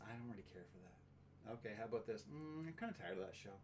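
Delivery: flat muttered no to pizza; sulky and very soft; low, quiet refusal. Retold or imitated. Acted picky partner.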